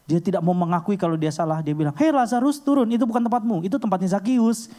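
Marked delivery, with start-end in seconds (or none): 2.0-4.7 high, loud call up a tree; bluffing Sunday-school teacher. Acted. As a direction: order him down, high and insistent. Acted teacher covering a mistake. (guru sekolah minggu berseru, nada tinggi)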